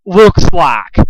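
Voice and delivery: high, loud, slow interrogation; furious demand. A narrator's reading. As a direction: spit out the order. Pinkamena interrogating.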